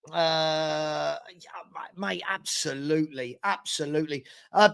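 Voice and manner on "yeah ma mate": ordinary talk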